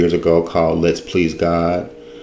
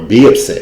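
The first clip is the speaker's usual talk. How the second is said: "be upset" with "be" punched louder; stress on be; 4 semitones higher, 7 dB louder than usual. Real, not acted. Speaker correcting own slip to listeners, emphatic stress on "be". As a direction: lean hard on "be" in "be upset".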